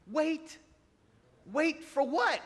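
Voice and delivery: high, incredulous squawk; mock outrage at being told to wait; indignant. Scoffing at the Lord telling him to wait, indignant and high. Mocking his own indignation.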